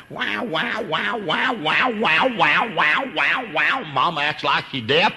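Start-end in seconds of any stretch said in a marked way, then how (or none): 0.1-3.8 put-on high wailing baby cry, fading down; imitating a crying baby. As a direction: mimic an infant's rising wah-wah wail. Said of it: "when they just".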